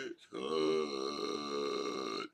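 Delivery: low, limp, mock-exhausted voice; mock wilted moan. Lying in the heat, a low wilted moan. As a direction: groan it like you're melting. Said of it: "I've been lying here going".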